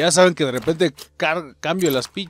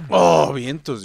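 ordinary talk, nothing marked

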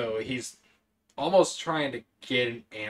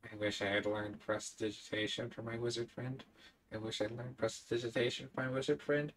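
muttering to himself; 10 dB quieter, faster than usual. Acted. Brix wishing for a spell for his wizard friend, muttering to himself. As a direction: mutter it quickly, barely meant to be heard. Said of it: "you hear Brix mutter to himself".

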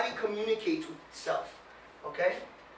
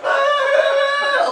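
sudden loud high laugh; loud high laugh burst; 14 semitones higher, 13 dB louder than usual. Real, not acted. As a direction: burst out laughing at your own act. Laughs after mock whine.

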